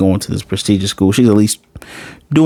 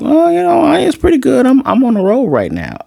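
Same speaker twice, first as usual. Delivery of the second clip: put-on much higher little-girl voice; high cartoon kid voice, imitating Anya; 3 dB louder than usual. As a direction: squeak it out like a proud small child. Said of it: "And she's like".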